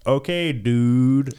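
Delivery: put-on slow, drawn-out California surfer accent; over-the-top California dude. A foreigner mimicking a California accent, slow and drawn out. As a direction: stretch it like a laid-back California dude. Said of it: "And then some guy's like".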